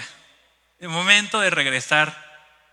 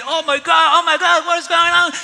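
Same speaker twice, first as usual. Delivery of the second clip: high, loud English exclamations; imitating excited foreigners; 10 semitones higher, 11 dB louder than usual. Foreign tourists rushing over in the lobby, imitated excited shouting. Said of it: "las personas extranjeras se acercaron".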